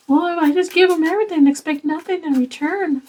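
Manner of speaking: high, mocking voice of a doormat; mocking whiny people-pleaser. Mocking the viewers who give everything, high whiny voice.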